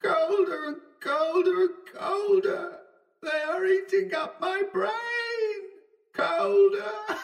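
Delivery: very high, drawn-out distant wails; keen it thinly and slowly, a madman lost in fog; hysterical wailing. A narrator's reading. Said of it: "hysterical shoutings that merged into prolonged wails".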